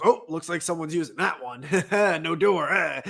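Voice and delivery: loud teasing passerby; mocking passerby. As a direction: play the passerby's dry quip. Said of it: "he goes".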